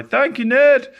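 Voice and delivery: loud, high cheering shout; crying out. A narrator's reading. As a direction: call gratefully up to someone in the sky. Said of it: "they cried".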